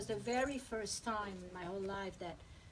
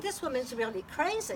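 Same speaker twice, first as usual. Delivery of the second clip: excited retelling; 5 semitones higher, 6 dB louder than usual. Retold or imitated. Retells her own reaction.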